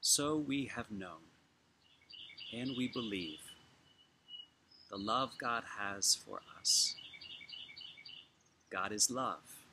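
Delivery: measured, unhurried reading, phrases left to settle; slow scripture reading. Real, not acted. Preacher reading First John to congregation, slowly, with long pauses. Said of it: "I will read it slowly".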